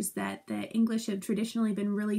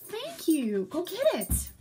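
higher pet-talk voice; talking to dog; 8 semitones higher, slower than usual. Real, not acted. Teacher throwing the dog's toy, high pet-talk. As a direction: praise the dog sweetly. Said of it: "It's the dog here".

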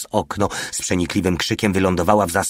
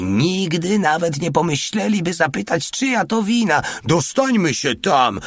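high, raised urgent voice; shouting; 10 semitones higher than usual. A narrator's reading. Little one urging the others across the square, shouting. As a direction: raise the pitch as if shouting over gunfire. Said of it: "krzyknął mały".

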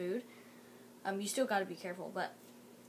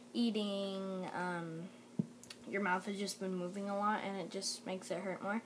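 ordinary talk

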